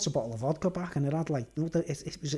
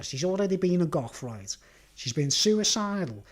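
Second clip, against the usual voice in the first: normal talking voice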